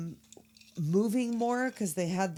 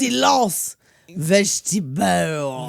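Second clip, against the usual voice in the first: creepy character voice; 7 dB louder, slower than usual. Retold or imitated. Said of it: "Oh, the voice is so".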